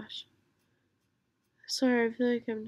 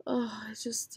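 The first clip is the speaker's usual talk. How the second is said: whisper. Real, not acted.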